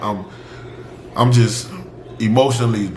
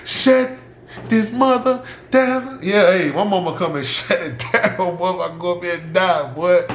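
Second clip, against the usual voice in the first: higher voice breaking into laughter; amused, laughing; 6 semitones higher than usual. Real, not acted. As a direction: tell it through laughs, pitched up. Laughs through imagined scene of mother.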